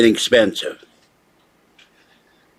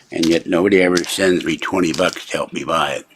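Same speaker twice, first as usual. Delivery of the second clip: ordinary talk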